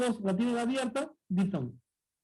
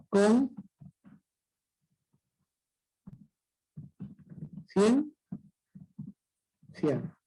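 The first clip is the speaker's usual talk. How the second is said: split into syllables; much slower than usual. Shown as a demonstration. Teacher shows how conciencia divides, isolated syllables. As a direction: dictate the syllables one by one. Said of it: "cómo sería conciencia".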